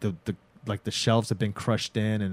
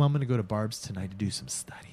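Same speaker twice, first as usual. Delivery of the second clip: whisper; 7 dB quieter, faster than usual. Retold or imitated. Nancy asking mom, retold.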